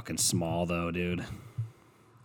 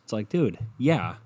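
ordinary talk

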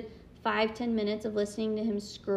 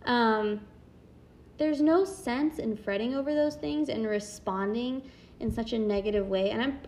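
ordinary talk

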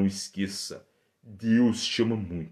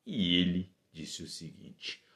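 ordinary talk, nothing marked

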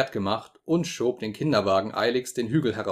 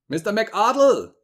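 much higher, loud, slow shout of a name; desperate shout; 11 semitones higher, 6 dB louder than usual. A narrator's reading. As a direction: shout his name into the dead phone. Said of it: "schrie ich".

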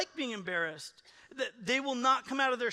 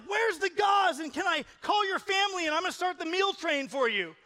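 much higher, louder, fussing shout; eager helper; 9 semitones higher, 5 dB louder than usual. Retold or imitated. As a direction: rush to help everyone at once. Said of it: "The two's gonna be like".

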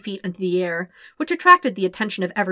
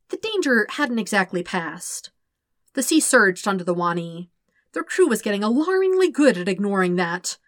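plain reading voice

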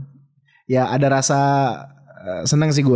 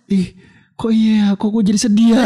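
high-pitched, playful self-pity; higher, half-joking woeful realisation; mock sudden sadness; 8 semitones higher, 3 dB louder than usual. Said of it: "ikut ngerasa".

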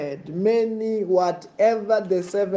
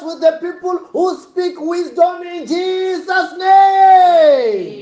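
fiery preaching; 11 semitones higher, 6 dB louder than usual. Acted. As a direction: roar the blessing over the crowd.

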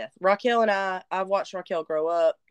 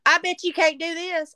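put-on teasing child; 9 semitones higher, 3 dB louder than usual. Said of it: "She says".

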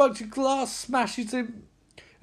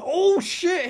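high, gleeful exclamation; high-pitched thrill; excited reaction; 10 semitones higher than usual. Retold or imitated. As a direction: burst out at the big hit. Reacting to a hit in the story.

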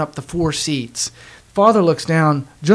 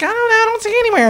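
high-pitched mock wife voice; imitated wife; faster than usual. Wife answering husband about theater seats, high mock whine.

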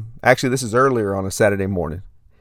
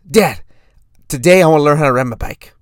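put-on eager little-kid voice, pitched up; impression of a young son; four-year-old son; 3 semitones higher, 4 dB louder than usual. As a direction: announce it like a determined small boy. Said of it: "do my best impression of my son".

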